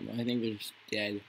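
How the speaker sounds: ordinary talk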